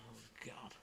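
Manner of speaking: watching husband fall, thinking in a whisper; whispered thought. Retold or imitated. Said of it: "And I'm thinking".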